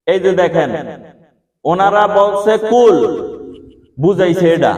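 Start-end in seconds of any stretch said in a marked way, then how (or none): 1.6-3.0 slow down and land hard on কুল; কুল said slowly and stressed to show it. Shown as a demonstration. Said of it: "উচ্চারণে লাগছে কি কুল".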